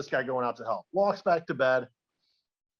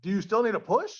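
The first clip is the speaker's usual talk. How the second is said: higher-pitched questioning call; acted calling out; 6 semitones higher than usual. Man offers the stranger a push, lightly called out.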